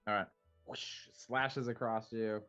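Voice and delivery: ordinary talk, nothing marked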